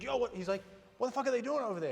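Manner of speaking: retold high-pitched, indignant disbelief; incredulous outburst. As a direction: imitate a colleague demanding to know what's happening. Voicing Jericho's startled reaction.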